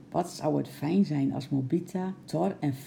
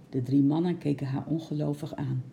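usual speaking voice

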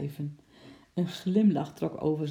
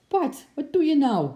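much higher, louder grumbling complaint; 11 semitones higher, 8 dB louder than usual. Acted. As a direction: grumble at him while getting up. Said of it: "bromde vader".

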